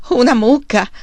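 loud, high, incredulous question; shouted. A narrator's reading. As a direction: shout it back excitedly. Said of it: "Charles gridò".

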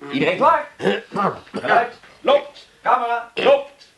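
high, carrying call-and-response; director's set calls. Acted. Director to his crew before shooting, calling out. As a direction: call out the roll for a take.

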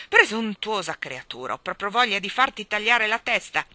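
loud, affronted royal threat; offended, sputtering. A narrator's reading. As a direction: scold with spluttering indignation. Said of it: "scattò il re offeso". (offeso, minaccia a voce alta)

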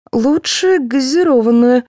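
high, slow, choked reply; strangled voice. A narrator's reading. Colleague asking for fizzy water, in a strangled voice. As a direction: squeeze the answer out. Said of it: "сдавленным голосом ответила Гарибальди".

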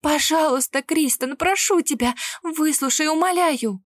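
high, loud begging through panic; desperate pleading. A narrator's reading. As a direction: beg him to listen, frantic. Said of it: "Голос, любимый, молящий".

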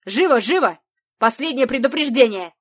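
loud barked warning over a crackling speaker; distorted loudspeaker shout. Acted. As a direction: bark it like a broken megaphone. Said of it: "Обладателя искажённого голоса".

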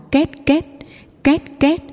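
squeaky creak rising high; creaking noise. A narrator's reading.

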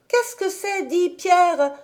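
put-on high child's voice, pressing for an answer; insistent question. A narrator's reading. Heidi repeats her question to Pierre, insistent. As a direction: ask insistently, full of wonder. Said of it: "répétait-elle".

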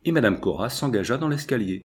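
ordinary delivery, nothing marked

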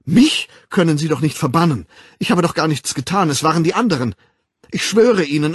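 hoch, laut und hastig die Unschuld beschwören; panisch beteuernd. A narrator's reading. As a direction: verzweifelt sich herausreden. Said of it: "Er zeigte anklagend". (Bernhard beteuert dem Controller panisch seine Unschuld)

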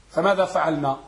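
ordinary talk

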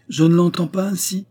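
protester plus haut et plus fort; protestation ferme. A narrator's reading. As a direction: refuser net, d'une voix forte. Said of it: "protestai-je". (le narrateur refuse de laisser partir son ami, avec force)

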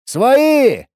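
loud high call; shouting. Acted. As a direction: call out to be heard. Calls out to crossbowmen.

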